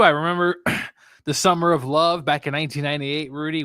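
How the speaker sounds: ordinary talk, nothing marked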